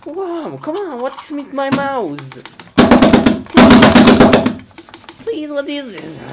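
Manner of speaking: high, desperate squeaks; shrill-pitched distress; high panicked pleading. Real, not acted. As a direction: beg the mouse to behave. Mouse still acting strangely.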